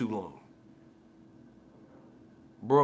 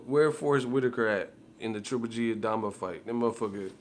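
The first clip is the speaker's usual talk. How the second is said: usual speaking voice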